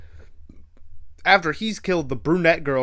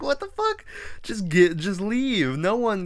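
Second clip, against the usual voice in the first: high, shocked what-the-fuck, pitched way up; disbelieving outburst; 8 semitones higher than usual. Retold or imitated. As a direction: blurt it out in total disbelief. Narrator reacts to death warning.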